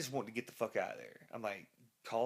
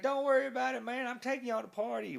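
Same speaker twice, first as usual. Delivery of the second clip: carefree driver; 11 semitones higher, 3 dB louder than usual. Retold or imitated. Driver brushing off the scared band, carefree and high. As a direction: sound like nothing could go wrong.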